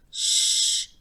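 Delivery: whispered shush; hush. A narrator's reading. Grandma hushing Johnny during the prayer. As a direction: hush him sharply under your breath. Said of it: "said Grandma".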